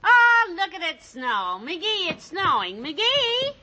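thrilled squeal at the first snow; excited about snow. Acted. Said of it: "Missus McGee looks out the window and says".

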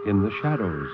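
ominous show opener; eerie radio host. Acted. Show opening, eerie host intro.

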